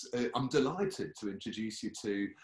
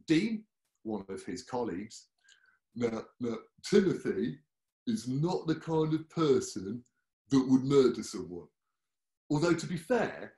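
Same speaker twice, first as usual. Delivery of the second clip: usual speaking voice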